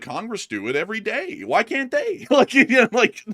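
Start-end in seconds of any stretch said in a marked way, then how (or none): 1.4-3.3 laughing. Real, not acted. Laughing at the Congress joke.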